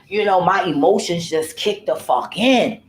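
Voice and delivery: usual speaking voice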